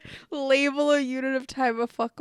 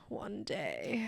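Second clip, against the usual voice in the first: soft unvoiced whisper; 2 semitones lower, 12 dB quieter than usual. Real, not acted. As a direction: breathe it out as a secret. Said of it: "whispered daydream".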